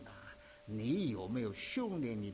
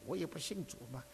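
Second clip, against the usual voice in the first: ordinary talk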